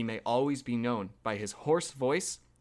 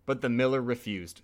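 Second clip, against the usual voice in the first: ordinary delivery, nothing marked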